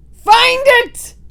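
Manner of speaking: high loud scream. Acted. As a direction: scream the order. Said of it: "screamed the Grand High Witch".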